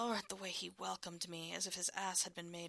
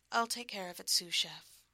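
louder, brighter-voiced promise; promising; 7 dB louder than usual. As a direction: say it with playful confidence. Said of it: "I promised".

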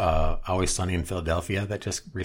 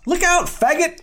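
sudden high-pitched yell, much louder; mock yell; 7 dB louder than usual. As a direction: bark out the warning in a squeaky cartoon shout. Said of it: "Frank yells out".

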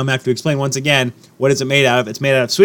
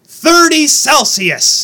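exasperated; 11 semitones higher, 4 dB louder, much slower than usual. Real, not acted. Complaining about heat.

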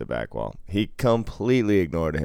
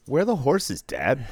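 put-on high childlike voice; daughter's question; 8 semitones higher than usual. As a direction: ask like a small kid, pitch up. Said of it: "my daughter goes".

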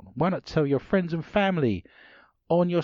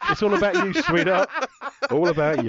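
pitched up and laughing, louder; high laughing partner voice; 12 semitones higher, 4 dB louder than usual. Acted. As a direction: tease as a cheeky partner. Mock partner gifting gym membership.

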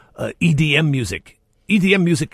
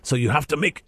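normal talking voice

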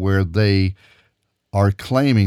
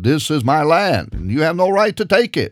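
a higher, insistent claim of ownership; 6 semitones higher than usual. Acted. Preacher voicing the Arabs' defiant claim, pitched up.

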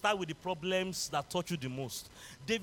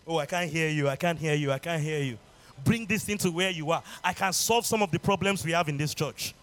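preaching; 6 dB louder than usual. Acted. Urging congregation louder.

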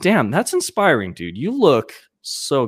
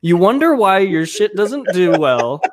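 high-pitched, exasperated mock rant; hammed-up indignant complaint; mock scolding; 9 semitones higher than usual. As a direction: playfully berate a friend, pitch climbing. Mock scolding friend over promotion.

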